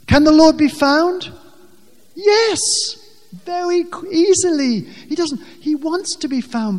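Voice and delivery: an excited, high, loud affirmation; fervent. Real, not acted. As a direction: cry out the answer with conviction. Preacher answers his own question.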